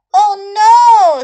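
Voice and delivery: high, loud dismayed princess cry; a princess wailing over her lost ball; cartoon princess.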